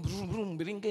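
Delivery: low mock engine rumble with lips; motor revving sound. Preacher imitates his motorcycle for the congregation, playful engine noise. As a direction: buzz out a playful motorcycle brum brum.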